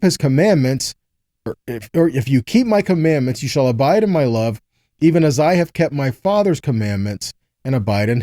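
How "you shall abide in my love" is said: ordinary talk, nothing marked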